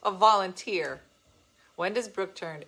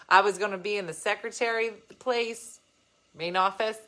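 usual speaking voice

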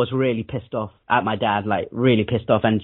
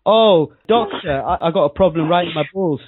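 mock schoolboy heckle; 5 semitones higher than usual. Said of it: "boys ... screaming and saying".

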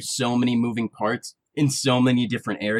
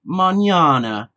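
slow, louder Italian-style mañana; Spanish with an Italian accent; 6 semitones higher, 6 dB louder, slower than usual. Retold or imitated. Friend recalls his Spanish with an Italian accent, slow and hammy. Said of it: "I'll never forget you just being like".

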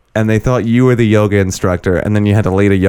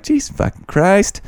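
exasperated exclamation; 11 semitones higher, slower than usual. Retold or imitated. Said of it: "It's like".

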